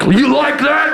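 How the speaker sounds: hammed-up victor's taunt. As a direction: gloat at the crowd. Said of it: "grabbing the mic".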